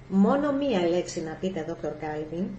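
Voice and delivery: ordinary delivery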